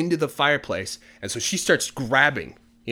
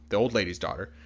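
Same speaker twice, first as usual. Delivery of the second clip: ordinary delivery, nothing marked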